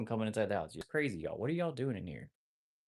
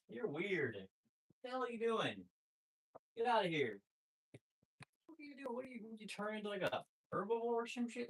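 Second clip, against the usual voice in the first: much higher, sing-song scolding of the puppy; high pet-talk voice; 12 semitones higher than usual. Real, not acted. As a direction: owner fussing at the puppy eating seeds, high pet-talk voice.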